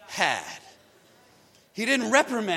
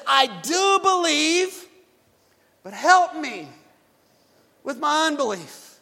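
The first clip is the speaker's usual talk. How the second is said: pleading father; 5 semitones higher, 6 dB louder, slower than usual. Acted. Desperate father pleads with Jesus.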